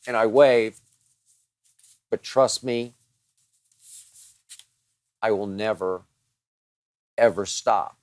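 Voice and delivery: normal talking voice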